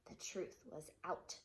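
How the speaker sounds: usual speaking voice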